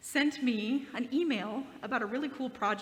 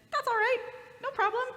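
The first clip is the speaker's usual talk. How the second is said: higher, louder breezy reassurance; imitating a cheerful friend; 12 semitones higher, 5 dB louder than usual. Dave forgives the pastor for the late reply, cheery and light. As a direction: brush it off like it's nothing. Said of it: "And he said".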